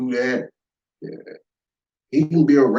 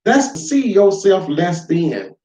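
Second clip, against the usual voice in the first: ordinary talk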